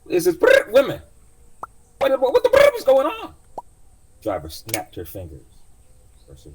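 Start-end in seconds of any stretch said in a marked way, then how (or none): 0.1-3.3 shrieking, very high and loud robot panic; panicking AI. Acted. AI companion panicking at the trapped passenger, shrill loud shriek. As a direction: scream it like a terrified AI. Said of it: "So chimed in again".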